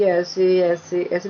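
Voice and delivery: slow, drawn-out ऐसे ऐसे ऐसे; demo of slow talking. Shown as a demonstration. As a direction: stretch each ऐसे out to show a slow wave. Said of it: "बहुत धीरे धीरे बोलता है". (धीरे धीरे खींचकर बोलना)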